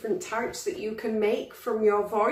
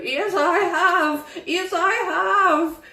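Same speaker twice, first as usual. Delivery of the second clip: put-on high, slow, drawn-out old person; 8 semitones higher, 3 dB louder, slower than usual. Shown as a demonstration. Teacher shows children an old voice, high and slow. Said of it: "an old voice".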